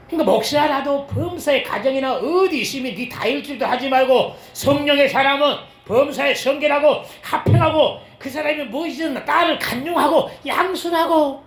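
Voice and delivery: high, loud preaching build; loud pulpit exhortation; fervent preaching. Acted. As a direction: preach it with rising fire. Preaching at full force.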